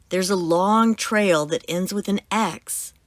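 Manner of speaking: ordinary delivery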